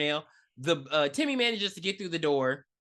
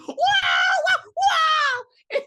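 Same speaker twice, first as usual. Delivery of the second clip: very high, loud imitation howl; cartoonish wail; 8 dB louder, much slower than usual. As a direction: wail like a cartoon, breaking into laughter. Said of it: "it reminded me of Who Framed Roger Rabbit".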